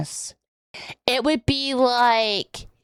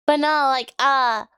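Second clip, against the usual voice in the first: usual speaking voice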